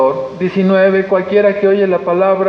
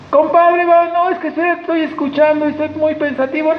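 much higher, playful voice of a pensive friend; mock pensive friend; 10 semitones higher than usual. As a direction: play the hesitant friend.

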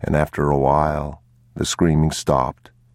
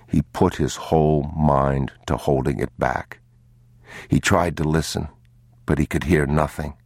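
ordinary delivery

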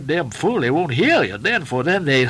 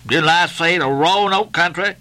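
sharp cry; 7 semitones higher, 4 dB louder than usual. A narrator's reading. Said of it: "he cried sharply".

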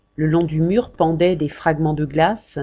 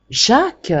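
high startled cry of the name; Daniel bumping into his brother on the stairs; 7 semitones higher, 4 dB louder than usual. Acted. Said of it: "cria-t-il en reculant".

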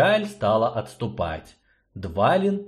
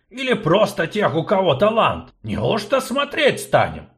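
urgent dwarf, louder; impatient gnome, loud; 8 semitones higher, 4 dB louder than usual. Acted. Gnome hurries sorceress, impatient. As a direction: hurry her along.

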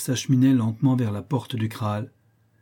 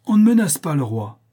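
angry king; 5 semitones higher, 6 dB louder than usual. A narrator's reading. King angered by threat.

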